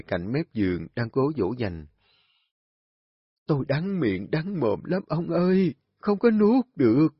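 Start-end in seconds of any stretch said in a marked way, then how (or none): 3.5-7.1 ailing mother pleading, rising to a cry; a sick old woman who can't swallow; weak complaining. Acted. Sick mother complaining to husband, voice rising.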